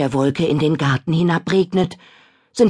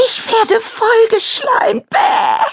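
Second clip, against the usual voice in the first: disgusted shout; 5 dB louder, slower than usual. Acted. Slime in her face.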